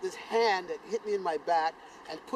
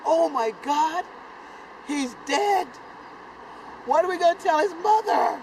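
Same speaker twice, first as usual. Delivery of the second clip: higher, louder panicked lament; 9 semitones higher, 8 dB louder than usual. Retold or imitated. Boys sure their friend is dead, higher panicked voice. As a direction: cry out in fear that he is gone.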